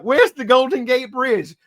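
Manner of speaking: a lost traveller looking around; puzzled tourist. Retold or imitated. Lost man quoted.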